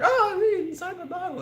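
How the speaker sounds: very high squeaky fangirl gushing; fawning fan voice. Pretend. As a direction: gush at her like a starstruck fan. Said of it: "I should be like".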